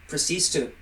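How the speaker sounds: usual speaking voice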